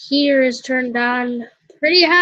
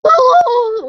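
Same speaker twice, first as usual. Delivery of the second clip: a higher, louder Oh by mistake; wrong voice; 10 semitones higher, 8 dB louder than usual. Acted. Player slipping into the wrong voice for the father, higher and louder. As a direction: start in the wrong character voice. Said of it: "wait, no, wrong voice".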